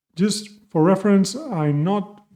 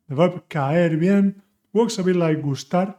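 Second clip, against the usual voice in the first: ordinary talk, nothing marked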